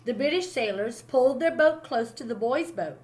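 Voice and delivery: usual speaking voice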